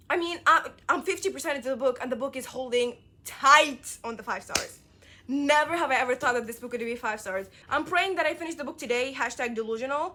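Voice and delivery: bubbling over with hope; thrilled reader telling viewers her progress; excited. Real, not acted. Excited reading update.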